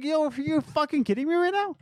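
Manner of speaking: high-pitched exasperated disbelief; frustrated. Retold or imitated. Employee recalling being gaslit by the boss, frustrated. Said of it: "I got so frustrated".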